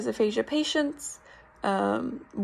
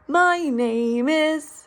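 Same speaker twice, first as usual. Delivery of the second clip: slow, higher, louder "My name is" with strong melodic stress; big intoned stress; 4 semitones higher, 4 dB louder, much slower than usual. Shown as a demonstration. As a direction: sing "My" up high and step it down. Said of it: "it's gonna be a big stress".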